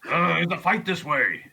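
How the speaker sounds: high, half-hearted yell; yelling back. Pretend. Player yells back to a party member in Orcish, mock yell. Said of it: "I'll yell back in Orcish".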